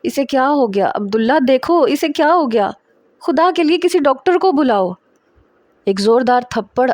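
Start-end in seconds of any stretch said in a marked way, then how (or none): 0.0-4.9 higher and rising, a mother begging in panic; frightened pleading. A narrator's reading. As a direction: beg someone to fetch a doctor, voice climbing. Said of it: "میری ماں رونے لگی". (روتی ہوئی گھبرائی ماں)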